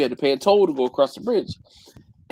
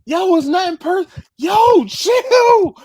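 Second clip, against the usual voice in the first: loud, very high-pitched mock yell; exasperated shout; 8 dB louder than usual. Telling an angry coworker to chill, exasperated high-pitched shout. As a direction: yell in comic disbelief, voice way up.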